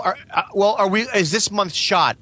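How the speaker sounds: normal talking voice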